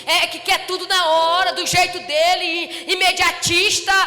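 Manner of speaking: loud, rising pulpit preaching; fervent preaching. Acted. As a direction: preach it hard to the congregation. Pregação no púlpito. (pregação fervorosa e alta)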